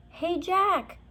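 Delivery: high call to brother; high, bright call across distance. A narrator's reading. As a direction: call out from the hilltop. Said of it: "Annie called".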